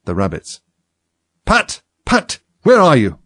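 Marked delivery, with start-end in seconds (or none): 1.4-3.2 high, angry shouts for a servant; irate character voice, loud and high. A narrator's reading. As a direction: call out crossly, pitch raised. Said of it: "Next came an angry voice".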